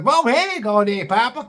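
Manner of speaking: put-on young bumpkin reply. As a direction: mumble it, only Papa clear. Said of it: "the young guy's responding".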